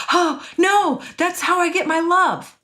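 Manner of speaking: high mock cry of dismay; mock alarm. A two's fear mocked in a high alarmed voice. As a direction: squeal it like a panicked two. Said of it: "they're like".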